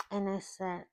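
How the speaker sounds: ordinary delivery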